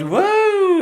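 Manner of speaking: high squeal of surprise; delighted whoa at the counter; surprised. Real, not acted. Streamer sees a new subscriber, surprised squeal.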